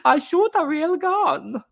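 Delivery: very high, slow, hammed-up game voice; mock Japanese game voice. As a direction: squeak it out slowly like the game's Japanese character. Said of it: "It's like".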